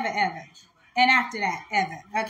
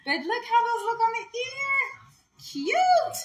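excited squeal; 8 semitones higher than usual. Real, not acted. Gushing over earrings.

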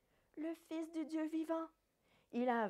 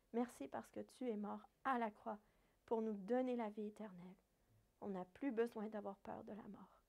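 prière calme et recueillie, un peu plus grave; 4 semitones lower than usual. Real, not acted. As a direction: prie doucement avec les enfants. Prière finale après le récit. (prière recueillie à voix posée)

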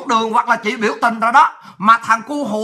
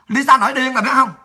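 ordinary talk, nothing marked

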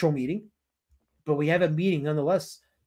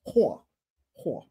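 New Jersey accent; 2 semitones lower, 3 dB quieter than usual. Shown as a demonstration. Streamer practises the insult, New Jersey accent. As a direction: drop the r, New Jersey style. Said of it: "with the New Jersey accent".

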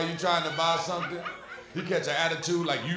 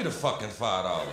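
low grumble; 11 semitones lower than usual. Retold or imitated. Robber pleading low.

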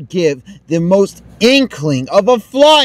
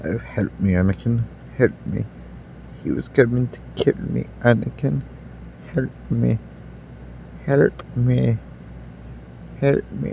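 put-on much lower, soft pleading old man; sad old man; 8 semitones lower, 11 dB quieter than usual. Old man begging Anakin for help, soft feeble plea. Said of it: "I'm such a sad old man".

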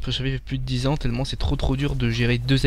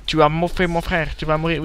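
higher, hammed-up avenging lion cub; mock vengeful threat; 3 semitones higher than usual. Cub threatens the dinosaur that ate his brother, hammed-up menace.

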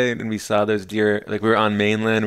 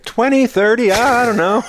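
loud, high offhand answer; high mock-breezy voice; imitated carefree visitor; 12 semitones higher, 5 dB louder than usual. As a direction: toss it off loudly as a clueless visitor.

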